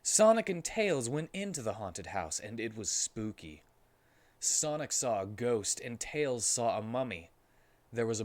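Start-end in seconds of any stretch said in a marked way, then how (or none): none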